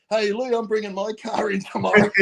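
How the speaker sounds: high-pitched mock holler; imitated mechanic's call. Mechanic teasing Lou about bringing his car in, imitated high call.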